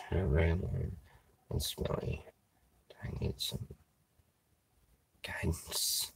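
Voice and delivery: barely audible, half-whispered read; very soft, trailing into a whisper; muttered reading. Real, not acted. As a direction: read it under your breath to yourself. Mutters chat message while first reading it.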